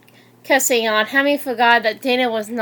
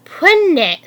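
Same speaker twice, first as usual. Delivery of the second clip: ordinary delivery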